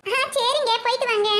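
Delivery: usual speaking voice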